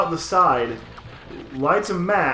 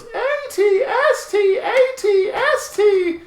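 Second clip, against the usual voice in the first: very high, slow, squealing ATST; overexcited fan squeal; 6 dB louder, slower than usual. Retold or imitated. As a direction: squeal it like a giddy fanboy. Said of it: "he goes".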